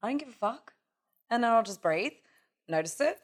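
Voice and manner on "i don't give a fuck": quiet, unbothered defiance; defiant shrug. Retold or imitated. Admitting her jealousy to herself, quietly defiant. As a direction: own it with a shrug. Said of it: "Now I'm just like".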